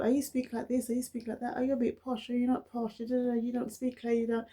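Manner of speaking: high-pitched mock nagging; lightly imitated chorus of fault-finders; mimicking critics. Mimics people's contradicting criticisms.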